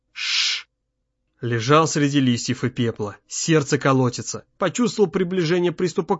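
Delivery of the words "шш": breathy hushing sound; unvoiced "shh"; sharp shush. A narrator's reading. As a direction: hush the child while hiding. Father hushes son in hiding.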